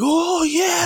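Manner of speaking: an imitated crowd yelling yes; mock crowd shout. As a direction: shout it back like a whole audience. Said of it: "And the crowd's like".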